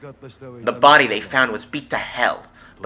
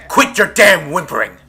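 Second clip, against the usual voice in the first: angry shout; 5 semitones higher, 6 dB louder than usual. Acted. Yakuza berating a scared kid.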